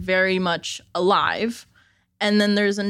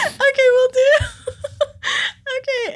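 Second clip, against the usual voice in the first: high-pitched giggly reply; laughing; 4 dB louder, faster than usual. Real, not acted. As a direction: still laughing as she answers. Laughing when told go ahead.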